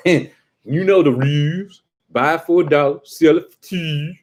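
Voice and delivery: slow, high mock Baltimore vowels; Baltimore accent. Comic still imitating a Baltimore local, high stretched Baltimore accent. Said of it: "But Baltimore".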